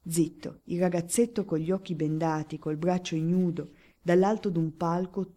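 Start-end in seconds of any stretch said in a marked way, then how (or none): none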